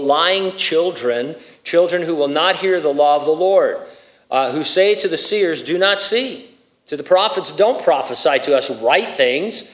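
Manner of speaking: loud, emphatic Bible reading from the pulpit; scripture reading. Acted. Reads scripture aloud forcefully.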